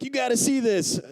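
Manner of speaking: high, excited imitation of a friend; eager. Friend behind on the plane showing something, eager. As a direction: pitch it up, urging someone to look.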